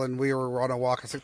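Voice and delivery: ordinary talk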